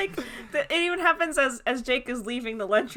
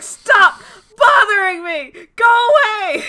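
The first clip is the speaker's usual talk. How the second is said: high, loud, drawn-out yelling; mock tantrum yell; 13 semitones higher, 14 dB louder, slower than usual. [name] yells at Adam to go away, hammed-up shouting. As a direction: scream him away in a hammed-up fit. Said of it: "He's like".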